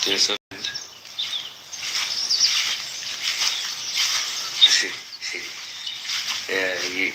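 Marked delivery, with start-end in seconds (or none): 0.4-6.4 long breathy fit of laughing; can't stop laughing at what he sees; real laughter. Real, not acted. Vlogger laughing at something on camera, long breathy laughter. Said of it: "you do not really know why I'm laughing".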